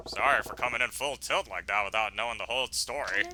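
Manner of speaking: lightly voiced low, calm man; a hint of a deep-pitched gentle hero; apologetic tough guy.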